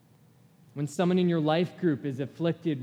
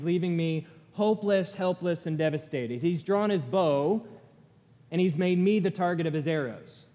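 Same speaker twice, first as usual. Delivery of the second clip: raised, pressing lament read with force; impassioned scripture reading; 3 semitones higher, 3 dB louder than usual. Acted. Pastor reads Jeremiah's lament to congregation, impassioned and raised. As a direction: read Jeremiah's complaint like it is your own pain.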